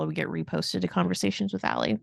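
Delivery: ordinary delivery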